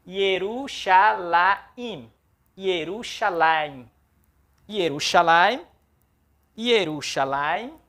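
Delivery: Yerushalayim slowly, ye-ru-sha-la-yim; Hebrew pronunciation. Shown as a demonstration. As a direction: say Yerushalayim slowly for students to repeat. (Yerushalayim pronunciado devagar)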